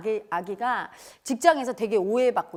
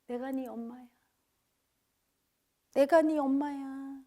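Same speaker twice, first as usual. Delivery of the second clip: slow, soothing mother's voice with drawn-out endings; tender mother. Acted. Divine mother calling her hurting baby to rest, tender and slow. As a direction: coax a hurting child close, gently. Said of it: "본래는 항상 이렇게 하고 있어요".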